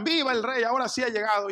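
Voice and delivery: a crowd hailing its king at last; crowd cheering. Retold or imitated. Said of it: "empezaron a gritar".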